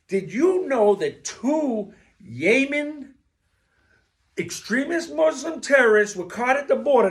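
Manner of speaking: loud, slow, high outrage; outraged reveal. Real, not acted. Telling viewers shocking border news, loud outrage. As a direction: drag it out, then shout.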